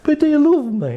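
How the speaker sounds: high nagging whine; pestering partner interrupting the darts, whining high; nagging plea. Retold or imitated. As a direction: pitch it up and keep pestering. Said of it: "with my mates, I'm playing darts".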